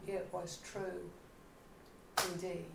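a hushed inward echo of wonder; quiet echo. Shown as a demonstration. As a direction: drop to a hush on "It was true".

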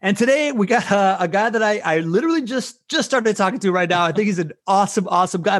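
fast, high, pumped-up host intro; hype man voice. Acted. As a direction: hype the crowd like an emcee. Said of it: "straight up hype man voice".

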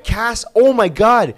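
high, loud, drawn-out exclamation; slow, high-pitched burst of excitement; real excitement. Real, not acted. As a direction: gush loudly as the memory hits. Excited sudden memory of birth news.

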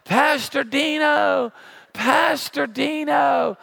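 slow mock call from a distance; distant yell. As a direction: call his name from far off. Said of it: "I hear someone yelling".

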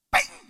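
high sharp ping sound; imitated ping. Preacher imitating the food hitting the plate, high ping. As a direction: make the clink of a metal plate.

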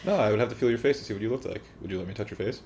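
usual speaking voice

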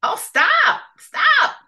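very high, loud begging cry; pained cry. Acted. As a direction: beg him to stop, ears hurting. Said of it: "cried the hump-tailed gator".

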